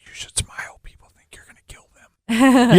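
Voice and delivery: a hushed, breathy aside in imitation; mock whisper. Husband imitating his wife's reminder to smile, whispering. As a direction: whisper it like a spouse nudging you.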